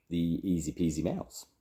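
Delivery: ordinary delivery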